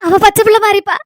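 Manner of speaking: weepy, halting plea; tearful pleading. Acted. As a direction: choke up between phrases, entrusting a beloved child. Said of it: "அழாதுங்க பாட்டி".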